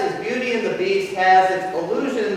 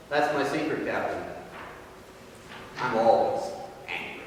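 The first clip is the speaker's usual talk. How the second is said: quiet menace; 8 semitones lower, 7 dB quieter than usual. Retold or imitated. Banner's quiet menacing Hulk line.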